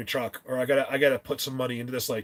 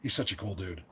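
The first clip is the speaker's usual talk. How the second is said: ordinary delivery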